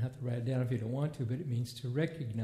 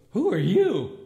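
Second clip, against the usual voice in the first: indignant; 4 semitones higher, 10 dB louder than usual. Retold or imitated. Buddy confronting fake Santa.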